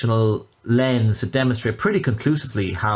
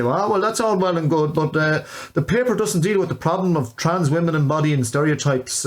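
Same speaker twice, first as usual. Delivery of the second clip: higher-pitched mock culchie Irish voice; culchie Irish accent impression; 6 semitones higher than usual. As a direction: put on a rural Irish accent to parody a critic. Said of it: "culty Irish accent".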